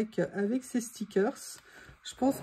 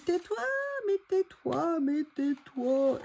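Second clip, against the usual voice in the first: higher, drawn-out mock scolding; voice telling her off; 6 semitones higher, slower than usual. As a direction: play your own voice pleading for silence. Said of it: "Elle dit".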